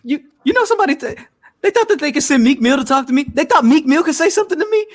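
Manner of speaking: high-pitched, worked-up retelling; mock outrage, pitched high and loud; imitated incredulous rant. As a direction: mimic someone ranting in disbelief, voice way up. Retelling a man ranting on Clubhouse.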